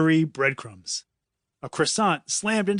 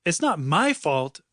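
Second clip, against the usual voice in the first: ordinary delivery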